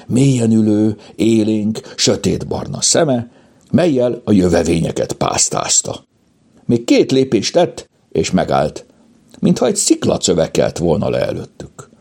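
ordinary delivery

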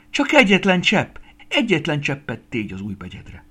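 loud, high cry, easing as it goes on; screamed plea. A narrator's reading. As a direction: cry out pleading, start at a scream. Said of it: "sikoltott Estike". (sikoltva könyörgő, hangos kiáltás)

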